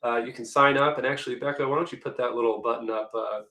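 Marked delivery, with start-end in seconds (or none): none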